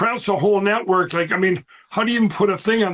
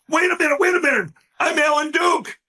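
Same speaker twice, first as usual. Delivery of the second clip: high, pleading mock protest, rising to a yell on his name; flustered protest; 8 semitones higher than usual. Fact checker protesting to hostile journalists, high flustered mock yell. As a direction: imitate a rattled man insisting on who he is.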